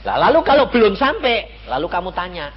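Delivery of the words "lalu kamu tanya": ordinary talk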